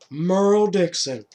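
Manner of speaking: name him to the crowd; announcing accusation. Retold or imitated. Governor denouncing Merle to the town, loudly.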